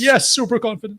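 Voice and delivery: high mocking echo; mocking imitation. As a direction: repeat his words in a high teasing voice. Mocking the clip's speaker.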